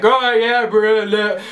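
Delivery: mock rowdy lad voice; lager lout. Imitating a man on the train, mock lager lout. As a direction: imitate a boozy lout's noisy banter. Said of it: "all lager loud team".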